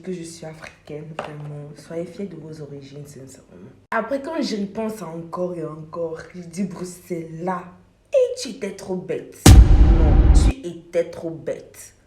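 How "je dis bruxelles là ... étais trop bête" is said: normal talking voice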